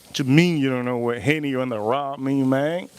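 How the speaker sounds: normal talking voice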